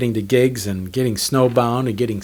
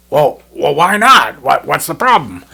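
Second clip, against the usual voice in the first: Bob's louder, surprised voice rising high; 3 semitones higher, 7 dB louder than usual. Retold or imitated. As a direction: play Bob caught off guard, louder. Bob's surprised reply, retold.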